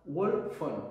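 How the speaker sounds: put-on "wall front" said slowly in a light British accent, "wol front"; drawn out like the English freerunners said it; British accent imitation. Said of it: "ellos decían el wall front". ("wall front" imitando el acento inglés, lento)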